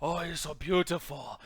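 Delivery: mock Japanese accent, slow and swooning; caricatured Japanese accent, drawn-out adoration; Ken Watanabe. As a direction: gush slowly in a hammed-up Japanese accent. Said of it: "these are racist ass accents".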